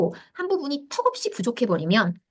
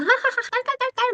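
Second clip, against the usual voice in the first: high mock giggling, much higher; imitating a child's laugh. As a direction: cackle like a kid watching comedy. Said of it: "막 이렇게 한 거죠". (높은 목소리로 깔깔 웃는 흉내)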